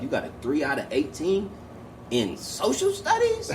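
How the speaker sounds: mock disbelief climbing into a squeak; imitated incredulous wife. Wife confronting son over bad grades, mock incredulous. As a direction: pitch leaping high on the subject. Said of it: "She like".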